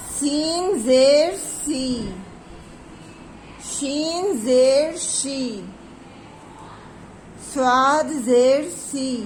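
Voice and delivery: very slow, drawn-out letter chant with falling ends; slow drilled reading. Shown as a demonstration. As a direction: chant each letter slowly and clearly for the class. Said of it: "इस आवाज़ को आप ध्यान से पढ़िएगा". (बहुत धीरे, खींचकर, हर अक्षर साफ़ पढ़ाना)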